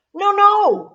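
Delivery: high, loud protest; bossy objection. A narrator's reading. Tara correcting Albert's line in the game, bossy. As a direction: cut in with a high, insistent objection.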